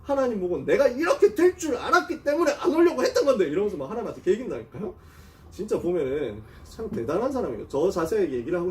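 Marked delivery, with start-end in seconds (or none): 0.0-3.5 mock-angry, higher-pitched protest; retold grumbling complaint pitched up; imitating indignant Jonah. Prophet talking back to God, mock indignant. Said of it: "요나가 너무 열받아가지고".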